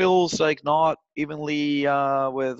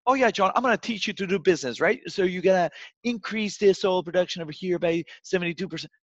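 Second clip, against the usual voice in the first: higher, mock know-it-all lecturing tone; condescending grad; 5 semitones higher than usual. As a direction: play a smug college kid talking down. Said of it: "Duke University college grad who comes in".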